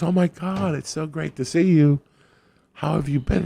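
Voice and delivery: a hammed-up overjoyed old flame; gushing greeting. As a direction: imitate her squealing delight at seeing him. He acts out her greeting.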